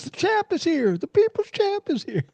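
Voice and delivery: very high, far-off taunting Ali voice; Muhammad Ali. Retold or imitated. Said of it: "He goes".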